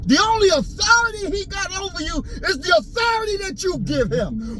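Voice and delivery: high, strained preaching shout; cracking shout. Real, not acted. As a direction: let the voice crack at the top. Peak of impassioned rant.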